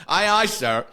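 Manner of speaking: high, loud acknowledgement of orders; mock-obedient reply. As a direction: play the rookie snapping to attention. Rookie acknowledges sergeant's rapid orders.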